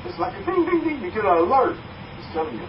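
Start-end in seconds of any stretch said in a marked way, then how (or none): 0.4-1.0 high ringing dings; market opening bell. Shown as a demonstration. Speaker mimics the market's opening bell, high dings. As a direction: imitate a bell going off, pitched high. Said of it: "when the market opens".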